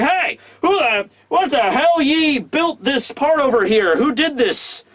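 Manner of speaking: put-on high, slow, barking old-fashioned foreman voice; mock angry Wano foreman; gruff foreman shout. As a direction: yell down at the workers like an angry boss. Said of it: "he's like".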